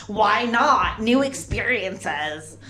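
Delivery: mock-perky openness, drawing out the last words; naive enthusiasm. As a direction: agree breezily, oblivious. Naive acceptance of bar invitation.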